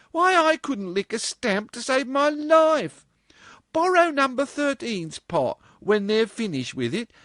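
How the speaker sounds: put-on eager girlish plea. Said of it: "cried Marjorie".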